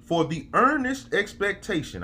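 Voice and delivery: slow, high, preacher cadence; preaching scripture. Acted. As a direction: read it like a sermon from the pulpit. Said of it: "This man said".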